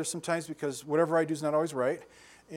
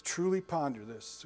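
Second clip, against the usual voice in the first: slow soft; 4 dB quieter, slower than usual. Shown as a demonstration. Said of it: "mull it over".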